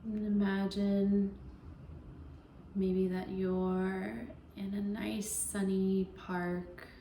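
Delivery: slow, even meditation voice with long pauses; guided meditation. Acted. Yoga teacher easing listeners into Yoga Nidra, guided meditation voice. Leads a guided relaxation practice.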